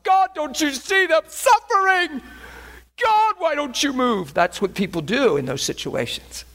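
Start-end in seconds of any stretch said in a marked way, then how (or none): 0.0-4.3 high, slow plea to God; pleading with God. Retold or imitated. People in trouble plead high and slow with God. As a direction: beg God to move, drawn out. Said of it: "wondering where God is".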